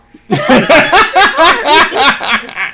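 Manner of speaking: high loud burst of laughter. Real, not acted. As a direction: crack up loudly.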